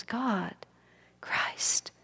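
hushed, fading words to God; soft faint calling out. Retold or imitated. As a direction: breathe each name softly, drifting off. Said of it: "like that".

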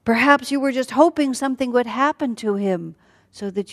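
high-pitched bitter accusation; worsening anger. Acted. Sita accuses Lakshman of wanting her, worsening anger. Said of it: "then she gets worse".

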